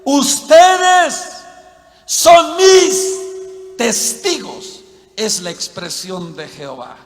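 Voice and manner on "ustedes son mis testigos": loud, high, very drawn-out verse; booming proclamation. Acted. Preacher quotes Jehovah's words from Isaiah, booming proclamation. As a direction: proclaim it slowly, stretching each word.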